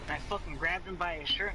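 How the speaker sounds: normal talking voice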